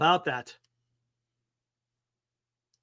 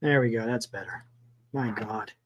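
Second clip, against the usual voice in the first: ordinary delivery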